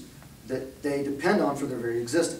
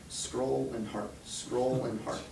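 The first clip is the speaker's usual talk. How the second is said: slow, softer, droning repetition; mindless scrolling rhythm; 2 semitones lower, 8 dB quieter, much slower than usual. Retold or imitated. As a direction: drag it out like endless scrolling. Mimics daughter's mindless scrolling.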